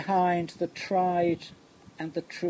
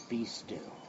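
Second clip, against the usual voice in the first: hushed command; 6 semitones lower, 10 dB quieter than usual. Acted. Jesus rebukes the waves.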